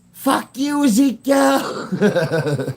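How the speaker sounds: high holler with a long-stretched name; imitated long-distance yell; mock yelled insult. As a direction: shout it playfully, dragging out the ending. Reenacting the yelled exchange.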